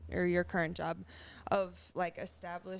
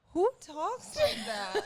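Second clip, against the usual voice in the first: mock astonished, much higher, louder and slow; incredulous; 13 semitones higher, 8 dB louder, slower than usual. Mom retelling her shocked reaction, high, loud and slow. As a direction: play it up as stunned disbelief.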